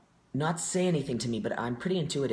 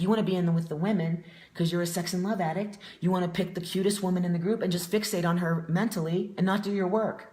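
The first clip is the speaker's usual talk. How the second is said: raised pitch, pointed accusing delivery; retold calling-out; 6 semitones higher, 4 dB louder than usual. Treatment staff calling out the new patient, pointed and higher. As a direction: imitate a confronting group leader.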